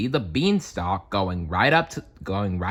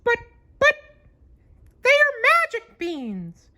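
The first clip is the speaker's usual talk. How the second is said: very high squeaky cartoon mouse; Mickey voice; 3 dB louder than usual. Mickey pleading with his friends over the beans. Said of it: "Mickey tried to explain".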